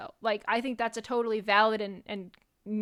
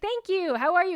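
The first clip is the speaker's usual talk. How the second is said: polite greeting; 7 semitones higher than usual. Retold or imitated. Retold greeting to a fan.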